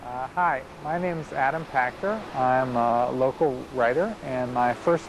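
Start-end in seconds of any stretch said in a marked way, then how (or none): none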